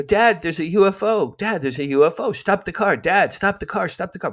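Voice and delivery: high, urgent kid's yell, half-acted; frantic yelling to dad. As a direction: shout at your father to stop the car. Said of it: "I'm screaming at my father".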